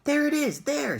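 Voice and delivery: high, loud excited shout. A narrator's reading. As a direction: point and shout over the crowd. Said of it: "someone else shouted".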